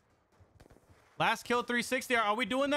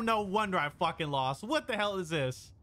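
usual speaking voice

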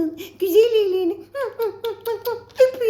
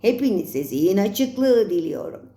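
mother voice; 9 semitones lower than usual. Acted. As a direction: lower motherly goodbye. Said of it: "mother continues farewell".

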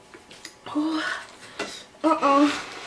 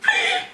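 high squeal laugh; 14 semitones higher than usual. Real, not acted. Laughing fit during spicy challenge.

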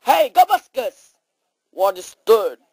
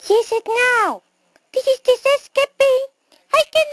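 very high, quick mouse chatter; 12 semitones higher, faster than usual. Acted. Mouse asking about watching the movie, quick mouse squeak. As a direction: squeak it fast like a tiny mouse. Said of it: "no more mouse voice".

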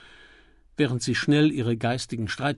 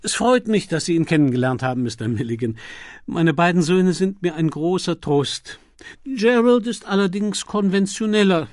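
higher, louder gushing dowager; gracious old duchess; 7 semitones higher, 7 dB louder than usual. Acted. The Duchess invites Milligan to sit beside her, gushing. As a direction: beam and draw him onto the sofa.

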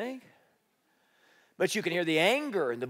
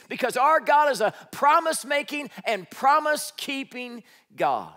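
preaching at full force; 7 semitones higher, 4 dB louder than usual. Real, not acted. Preaching climax.

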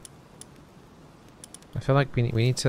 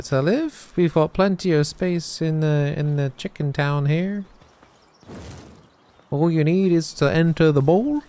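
cutesy welcome; 5 semitones higher than usual. Pretend. Player welcoming chickadees to chicken town, cutesy voice. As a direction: coax the chickadees in sweetly.

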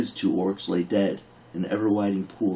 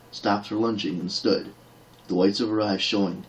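plain reading voice

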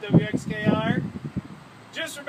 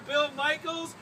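ordinary talk, nothing marked

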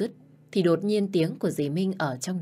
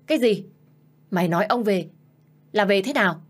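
shocked outburst; 3 semitones higher, 5 dB louder than usual. Acted. Stepmother shocked at news.

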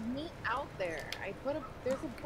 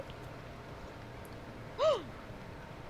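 dramatic gasp; 10 semitones higher, 4 dB quieter than usual. Pretend. Meets own double, mock shock.

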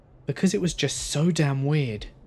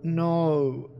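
groggy, drawn-out, higher no; groggy mumble; 4 semitones higher than usual. A narrator's reading. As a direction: mumble it as if half asleep. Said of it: "in a very groggy voice".

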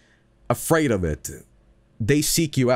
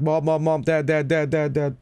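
mock-frantic calls for parents, pitched up; retold crying; 4 semitones higher than usual. Kid running home to parents, retold tearful calling. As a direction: imitate a child calling out over and over. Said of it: "crying, like".

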